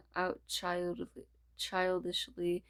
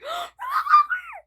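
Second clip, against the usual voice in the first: much higher, louder squealing gasp; joyous gasp; 13 dB louder than usual. Acted. Character gasps in squealing joy at the squad. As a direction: gasp with laughing delight.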